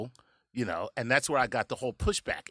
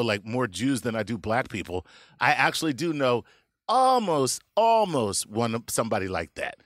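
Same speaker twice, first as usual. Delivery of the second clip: normal talking voice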